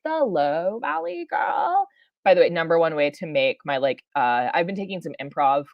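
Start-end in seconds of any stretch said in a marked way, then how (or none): 0.0-1.9 a hint of a higher, slow Valley Girl lilt. Shown as a demonstration. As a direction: toss off the name in the voice itself. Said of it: "So we do, like".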